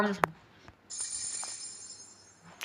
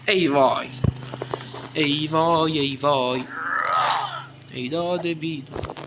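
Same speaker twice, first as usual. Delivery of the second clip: drawn-out comic groan of dismay; mock lament; 2 semitones lower, slower than usual. Real, not acted. Gamer bemoaning a hit to his team, hammed-up dismay. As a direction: wail it, fading to a slow sigh. Losing health in the match.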